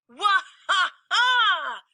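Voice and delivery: big loud mocking belly laugh; booming bear laugh. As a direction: laugh off the puny squirrel. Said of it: "but then he laughed".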